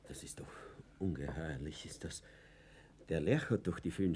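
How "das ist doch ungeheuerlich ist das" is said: whispered in disbelief; shocked whisper. Acted. Fuchs whispers his shock to the imprisoned professor. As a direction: breathe it out in horror.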